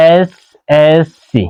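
এসএসসি said very slowly, opening on an এ vowel sound; vowel-first demo. Shown as a demonstration. Teacher slowly models এসএসসি for students, vowel start. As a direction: drag out এসএসসি so the এ start is clear. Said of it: "প্রথমে এই এস".

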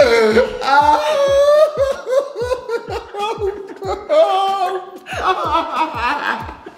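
a big shrieking laugh. Real, not acted. Reacting to his burning mouth.

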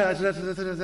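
Ř said three times in a row; rapid repeated Ř sounds; repeated Ř demo. Shown as a demonstration. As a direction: drill the Ř sound. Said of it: "you don't wanna go around saying R".

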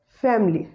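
"family" as two syllables, FAM-lee; schwa after M deleted. Shown as a demonstration. Teacher showing the silent schwa, "family" said as FAM-lee. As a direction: run M straight into L in "family". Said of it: "will be pronounced as".

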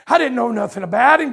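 ordinary talk, nothing marked

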